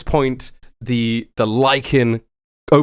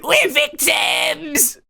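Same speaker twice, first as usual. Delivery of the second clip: high, long drawn-out cry; mock victim wail; 13 semitones higher, much slower than usual. Scammers playing victims to the street, high drawn-out wail. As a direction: wail it out for the crowd. Hammed-up public plea as victims.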